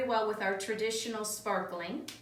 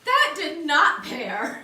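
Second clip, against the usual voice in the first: laughing disgust; 5 semitones higher, 8 dB louder, much slower than usual. Real, not acted. Reacting to bad pairing.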